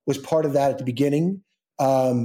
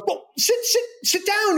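very high, fumbling invitation; hammed-up flustered star. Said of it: "completely, like, flustered".

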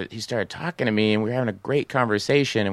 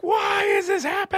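very high-pitched despairing cry; mock anguished wail. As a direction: wail at fate in comic agony. Retold despair in the cab.

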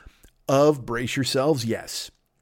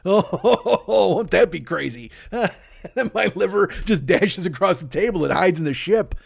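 real laughter through the words; giggling at his own absurd movie gag; laughing; 5 semitones higher than usual. Real, not acted. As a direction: laugh while picturing the scene. Laughing at his own Alien riff.

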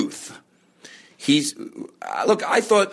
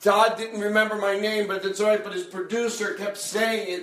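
ordinary delivery

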